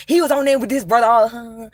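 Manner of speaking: ordinary talk, nothing marked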